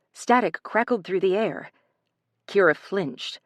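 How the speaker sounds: ordinary delivery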